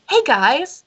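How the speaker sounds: high-pitched, happy-all-the-time greeting; Oggy. Acted. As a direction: chirp it up high, relentlessly happy. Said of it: "the way he talks is just like".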